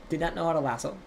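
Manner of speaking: usual speaking voice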